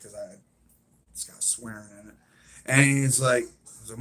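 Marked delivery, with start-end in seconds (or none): none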